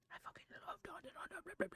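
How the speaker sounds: airy whisper, all breath and no voice. Real, not acted. Gushing to chat about a beloved anime, whispered. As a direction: breathe it out like a secret confession.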